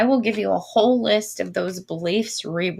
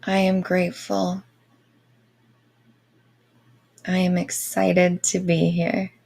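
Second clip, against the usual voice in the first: airy breathy mystical healer. Acted. Guided Reiki meditation.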